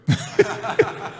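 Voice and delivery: real high breathy laugh; preacher laughing with delight. Real, not acted. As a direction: laugh out at the end of the story. Laugh after the story.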